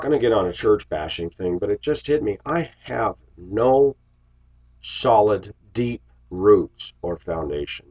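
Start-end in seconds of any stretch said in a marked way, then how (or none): none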